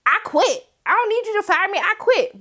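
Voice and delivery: high, defiant sass; high proud defiance; defiant quit. Retold or imitated. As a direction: quit before she's fired. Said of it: "the girl should be like".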